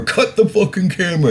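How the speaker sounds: mock flustered politician. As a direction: squeak it like a caught-out minister begging. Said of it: "waving at the camera".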